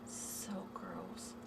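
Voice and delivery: a soft, unvoiced whisper of disgust; whispered so gross. Real, not acted. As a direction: breathe it out, grossed out. Showing bruised toe to viewers.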